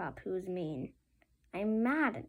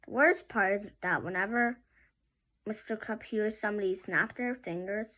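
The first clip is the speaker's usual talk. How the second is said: usual speaking voice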